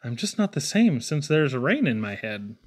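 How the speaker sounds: plain reading voice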